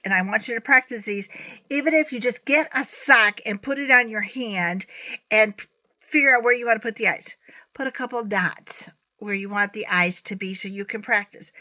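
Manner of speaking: ordinary talk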